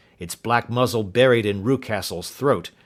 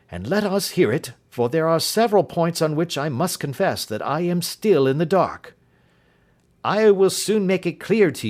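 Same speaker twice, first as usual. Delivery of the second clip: lightly voiced servant woman, higher; a loyal servant eager to explain; Mrs Toller, earnest; 7 semitones higher than usual. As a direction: housekeeper confessing what she knows. Mrs Toller tells her story.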